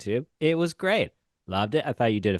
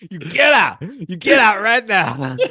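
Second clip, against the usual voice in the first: high, loud re-enacted eviction; mock furious yelling; 10 semitones higher, 4 dB louder, slower than usual. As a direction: play at throwing someone out, slowing as you go. Re-enacts screamed order to leave.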